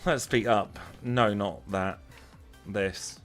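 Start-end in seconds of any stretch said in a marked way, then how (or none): none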